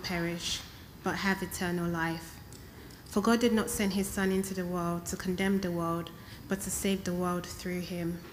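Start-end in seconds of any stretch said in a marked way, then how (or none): none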